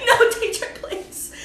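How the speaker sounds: high, laughing plea of students; hammed-up student whining through laughter; mock whiny students. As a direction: whine it like a student begging off. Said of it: "Estaban como".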